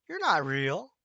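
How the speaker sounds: loud, taunting wild-rabbit cry; mocking exclaim. Wild rabbit taunts the velveteen rabbit, loud and jeering. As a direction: jeer at the toy while hopping. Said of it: "exclaimed the rabbit".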